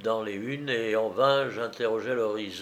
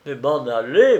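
loud, indignant outcry; protestation criée; 2 semitones higher, 9 dB louder than usual. A narrator's reading. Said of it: "m'écriai-je". (s'écrier fort pour protester)

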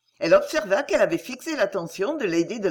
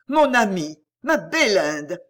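much louder, anguished exclamation; bitter cry; 5 dB louder, slower than usual. A narrator's reading. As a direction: hurl the words with bitter irony. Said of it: "s'écria lady Delacour". (cri amer et ironique, bien plus fort)

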